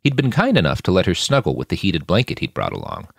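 plain reading voice

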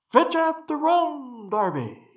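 slow, high ghostly call, dying away. A narrator's reading. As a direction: wail it high, letting the name fade. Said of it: "then rising a little higher".

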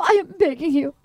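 hammed-up desperate, sobby plea; imitating sobbing pleas. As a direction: plead like someone in tears. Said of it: "And she's sobbing".

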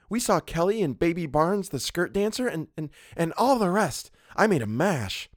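put-on high, girlish gossip; chattering young model. A narrator's reading. Model boasting of her night out, chattering. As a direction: chatter on, pleased with yourself. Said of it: "she began to chatter".

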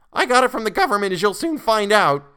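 very high, loud defiant retort; defiant boy. A narrator's reading. Boy defies the colonel, very high and loud.

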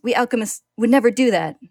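loud, desperate denial; screaming. A narrator's reading. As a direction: shout it through the punches. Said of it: "he screams".

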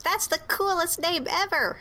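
put-on high excited child; kid voice. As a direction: pipe up like an excited little kid. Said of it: "One of the kids pipes up and says".

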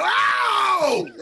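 loud, high burst of surprise; sudden yelled oh; shocked exclamation. Retold or imitated. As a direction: explode with surprise at the knockout. Reliving the knockout moment.

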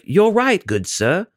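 lightly voiced posh mom conceding; mock polite mom. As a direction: play an impossibly well-mannered mother. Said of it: "mom's gonna be like".

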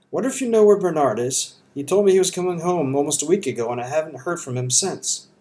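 a hint of a concerned Georgia mother asking after her son; worried elderly mother. A narrator's reading. Mrs Samuelson asking about Bernard.

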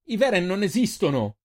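high, loud protest. Acted. Boy loudly protesting the beast can't exist. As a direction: insist angrily. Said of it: "protestò il ragazzo".

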